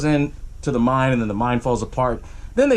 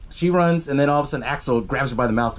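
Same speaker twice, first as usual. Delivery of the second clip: ordinary delivery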